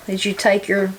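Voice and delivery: normal talking voice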